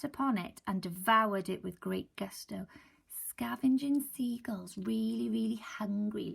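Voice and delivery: whispered storytelling. Real, not acted. Teacher explaining hungry seagulls, whispered. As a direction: hush it, drawing them in.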